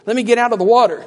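voiced prayer, pushed up in pitch and volume; insistent appeal. Voicing a prayer not said.

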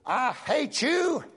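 slow, high, stretched "I hate you"; drawn-out high cry. Retold or imitated. Retelling the woman who hated him, slow high wail. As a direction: wail the words slowly and high. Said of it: "she said".

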